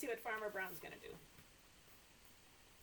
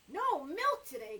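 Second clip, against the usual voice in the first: cried out; 3 semitones higher, 8 dB louder than usual. Acted. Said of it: "cried Farmer Brown".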